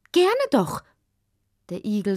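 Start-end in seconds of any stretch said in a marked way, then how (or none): 0.1-0.8 high, bright, eager yes; delighted. Acted. Hedgehog gladly accepts the mole's offer, delighted. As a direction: burst out with glad relief.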